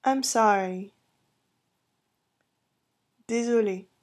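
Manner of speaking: normal talking voice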